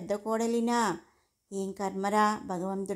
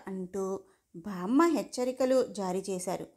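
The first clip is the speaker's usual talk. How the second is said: ordinary delivery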